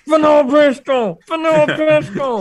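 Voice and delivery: very high cartoon voice; South Park voice. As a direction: squeak it like a South Park kid. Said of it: "he sounds like that South Park".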